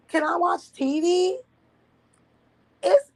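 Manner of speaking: put-on high, whiny child begging; whiny kid. As her childhood self whining at the boy outside. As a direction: plead like a kid stuck indoors.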